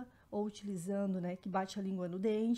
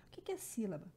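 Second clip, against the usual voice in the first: whisper; 6 dB quieter, much faster than usual. Real, not acted. Teacher poses lesson question.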